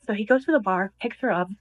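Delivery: ordinary talk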